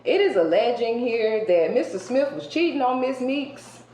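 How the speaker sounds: ordinary talk, nothing marked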